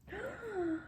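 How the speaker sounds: sharp breathy gasp; sudden high gasp; surprised gasp. Real, not acted. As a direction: gasp in delight. Gasp at discovery.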